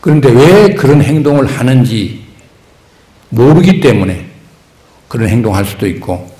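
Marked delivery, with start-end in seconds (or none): none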